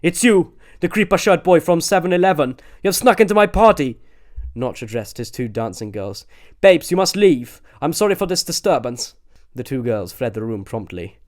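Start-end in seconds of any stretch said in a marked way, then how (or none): 0.0-9.2 higher, louder indignant man; a hint of huffy Notch; lightly voiced Notch. A narrator's reading. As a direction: accuse them, put out. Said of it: "Notch speaking".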